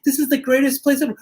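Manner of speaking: high, fast excited gush; rushing, thrilled tour voice. Real, not acted. Excited house tour opening.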